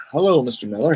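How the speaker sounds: ordinary delivery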